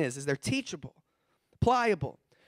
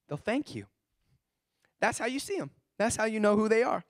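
ordinary talk

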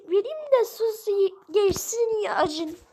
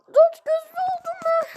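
much higher, louder cry of shock; high shocked wail; 11 semitones higher, 5 dB louder than usual. Acted. The hurt one wails to friends, high and shocked. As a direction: shriek it like you just got hurt. Wailing after getting hurt.

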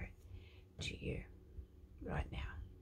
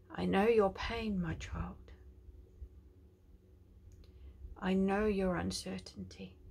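tender prophetic declaration; 3 semitones higher, 3 dB louder than usual. Acted. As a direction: speak as God comforting his child. God's voice to listener.

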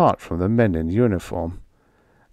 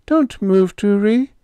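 put-on high, loud, trembling call of a girl; quavering call; 13 semitones higher, 5 dB louder than usual. A narrator's reading. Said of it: "the girl called".

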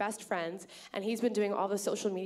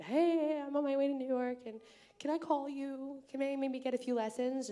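nervous asking; 6 semitones higher than usual. Retold or imitated. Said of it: "I was very nervous to talk to her".

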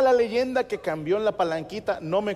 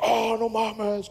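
long drawn-out "oh" of amazement; awestruck kids; 3 semitones higher, much slower than usual. Retold or imitated. Kids seeing René's dream bike, awed. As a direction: marvel slowly, imitating amazed kids.

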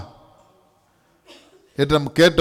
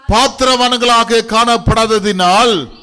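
emphatic scripture reading; 7 semitones higher, 5 dB louder than usual. Real, not acted.

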